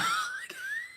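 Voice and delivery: sudden high-pitched laugh; high squeal of laughter. Real, not acted. She bursts out laughing at a viewer's username. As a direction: squeal with amusement.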